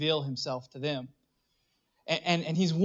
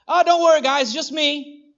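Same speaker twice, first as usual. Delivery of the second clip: mock casual; 10 semitones higher, 7 dB louder than usual. Jesus mocked as casually reassuring the disciples, offhand. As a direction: shrug it off like a buddy showing up. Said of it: "Not only just, "It is I," right?".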